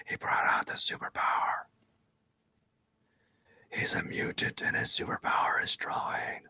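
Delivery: hushed, breathless whisper of a crushed rival; a jealous artist whispering in mock despair; awestruck despairing whisper. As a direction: whisper it like someone losing all hope. Imitating a crushed jealous viewer.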